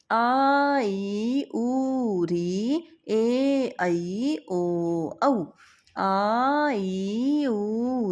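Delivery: drawn-out drill from आ through औ; long vowels. Shown as a demonstration. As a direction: chant आ, ई, उ, each held long. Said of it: "long time लगे".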